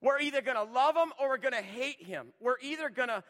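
loud, driving pulpit preaching; preaching at full force. Acted. Preacher pressing the church to choose, loud and driving. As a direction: hammer each either-or line home to the congregation. Preacher drives the point home.

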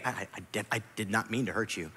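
low, very soft, halting start; soft admission. Acted. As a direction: own it quietly and humbly. Models a gentle, merciful admission.

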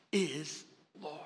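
sudden hush on is Lord; whispered ending. Real, not acted. Preacher closing the confession, whispering. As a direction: drop to a reverent whisper.

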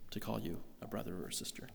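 whisper. Real, not acted. Tender point about Jesus' love.

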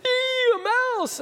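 very high, slow squeal of fright; mock shriek. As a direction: squeal it like a mom up on the counter. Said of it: "she would be up on the counter".

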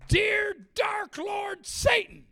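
high, slow, loud mock incantation; mock satanic invocation. Imitating girl praying to the dark lord, mock incantation. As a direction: intone it slowly like an overdone invocation. Mimics the girl's prayer to Satan.

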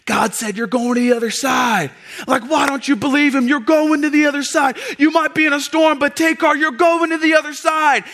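high, loud exhortation; fired-up preaching. Acted. Preacher voicing how the storm passage gets preached, high fervent shout. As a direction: cry it out high, urging faith through the storm. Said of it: "or hear it spoken as".

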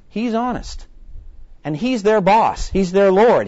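preacher punching each line; emphatic preaching. Real, not acted. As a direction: proclaim it to the congregation. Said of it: "preaching emphatic climax".

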